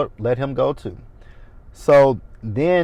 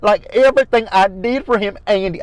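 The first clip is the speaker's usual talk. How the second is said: high, loud mocking of her whiny accent; Karen's accent; 7 semitones higher, 5 dB louder than usual. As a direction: whine it out in her put-on accent, climbing. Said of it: "I hate that accent that she has".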